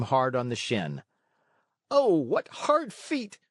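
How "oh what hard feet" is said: high, drawn-out yelp of a kicked schoolboy; cry of pain. A narrator's reading. Schoolboy kicked on the shin, high slow pained cry. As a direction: cry out slowly, stung by the kick. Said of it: "cried the boy".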